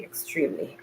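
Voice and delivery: weary whispered moan from the heat; whispering. Real, not acted. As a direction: whisper it, drained and whining. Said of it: "I sound like a whining".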